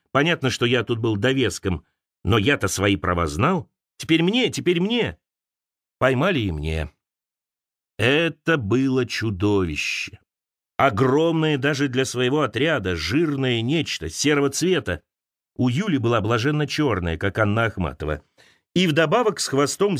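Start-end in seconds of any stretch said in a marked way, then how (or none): none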